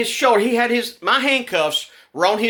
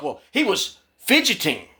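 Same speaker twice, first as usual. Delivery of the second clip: mock officer excuse. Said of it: "he's like".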